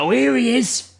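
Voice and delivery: high, drawn-out mock announcement; mocking jeer. Drunk girlfriend as boyfriend returns, high mocking jeer. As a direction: imitate a drunk singling someone out in a high voice.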